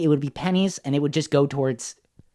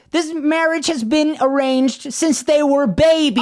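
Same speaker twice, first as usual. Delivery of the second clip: high, slow, hammed-up indignation; haughty Lady Catherine; 10 semitones higher, 3 dB louder, slower than usual. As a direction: declare it as an outraged grande dame, stretched out and high. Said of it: "that's my impression of her".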